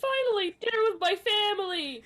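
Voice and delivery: high-pitched mock delight; happy dad. Imitating dad finally at dinner with his family, high delighted gushing. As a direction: gush like a dad glad at last. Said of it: "He's so happy".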